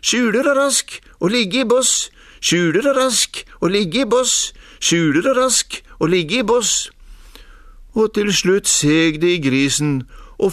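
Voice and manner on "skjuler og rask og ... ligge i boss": high, insistent squeaky chant; shrill piping fox. A narrator's reading. Fox keeps working on the pig, piping shrilly. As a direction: keep piping it high, over and over. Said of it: "Men reven drev på".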